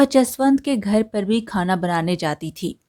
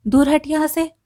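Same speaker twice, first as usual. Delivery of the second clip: put-on scornful boy; 7 semitones higher than usual. A narrator's reading. Rich boy pushes poor friend away.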